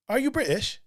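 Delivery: a quick stab at a British voice; a jokey British accent, tried out on request. Shown as a demonstration. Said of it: "I do now have a British accent".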